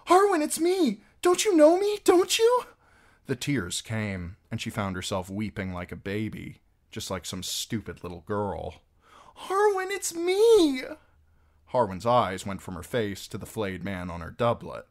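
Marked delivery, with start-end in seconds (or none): 0.1-2.6 put-on high, loud pleading girl voice. A narrator's reading. Captive girl begging Harwin to know her, high loud pleading. 9.3-10.8 put-on high, loud weeping girl; tearful plea. A narrator's reading. Weeping girl pleading with Harwin, high tearful cry.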